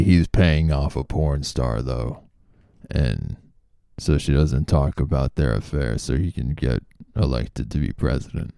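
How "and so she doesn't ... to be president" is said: usual speaking voice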